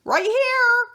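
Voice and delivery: put-on dog calling back to girl searching for him. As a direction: answer loudly from afar. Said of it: "I call back".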